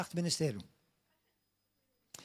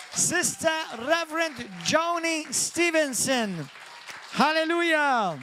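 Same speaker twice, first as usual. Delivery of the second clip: announcer welcome; 4 semitones higher than usual. Real, not acted. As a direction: build the crowd up to the welcome. Announcer welcomes guest preacher.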